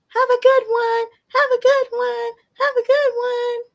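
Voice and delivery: put-on very high, loud baby voice; baby voice for son. Mother voicing her baby son to the viewers in a high, loud baby voice. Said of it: "So Amir just says".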